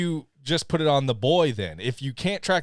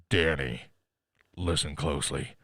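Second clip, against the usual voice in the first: lower, hushed imitation of the real father; low menacing dad voice; 10 semitones lower, 4 dB quieter than usual. Retelling the dad on the phone to the boy, low and ominous. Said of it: "And he goes".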